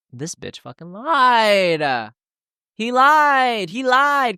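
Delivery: high, slow outraged wail; exasperated friends realizing the German man lied; mock outrage. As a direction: whine that he lied.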